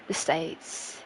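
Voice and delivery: "The States" breathed out in a whisper; whispered rehearsal. Shown as a demonstration. Streamer quietly trying out a phrase, whispered. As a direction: whisper "The States" to yourself, testing it. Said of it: "Should I just say the States?".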